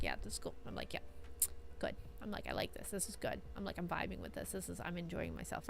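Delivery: low, muted deadpan approval; old person watching. Retold or imitated. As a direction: mumble mild enjoyment like a tired elder. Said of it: "like an old person watching".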